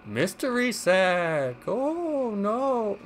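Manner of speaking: slow, high spooky ghost voice; haunted house voice. Pretend. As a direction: draw the words out like a haunted house host. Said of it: "What am I doing haunted house voice for?".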